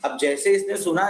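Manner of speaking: ordinary talk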